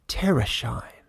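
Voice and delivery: a bare whisper of the name. Acted. Mother whispers the city's name back to her daughter, whispered. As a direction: breathe the word Terrashine. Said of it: "answered Verliston".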